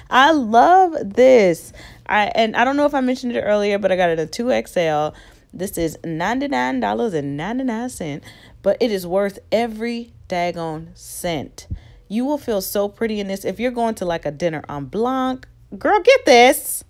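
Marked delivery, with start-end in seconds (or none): 0.1-1.7 loud, slow, drawn-out love; excited gush. Real, not acted. Creator to viewers, loudly gushing over the dress. 15.8-16.8 loud, high, slow hype; hyped urging. Real, not acted. Creator urging girlfriends to buy the dress, loud and high.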